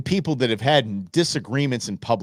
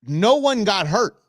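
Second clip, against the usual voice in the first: ordinary delivery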